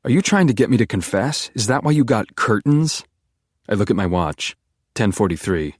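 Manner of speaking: ordinary delivery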